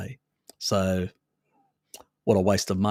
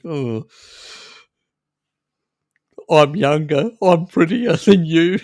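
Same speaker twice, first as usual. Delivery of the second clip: smug, high, loud taunting boast; ugly stepsister; 7 semitones higher, 8 dB louder than usual. Retold or imitated. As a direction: gloat like a vain sister, laughing.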